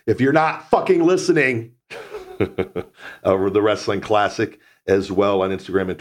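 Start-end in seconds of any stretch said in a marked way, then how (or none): 0.0-1.7 higher, louder mock rant; playful exasperated outburst. Real, not acted. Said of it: "Gonna say it for the second week". 1.9-2.8 short laugh. Real, not acted. Laugh after mock rant.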